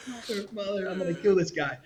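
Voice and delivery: high, hushed exasperated groan; a hint of fed-up swearing under breath; mock exasperation.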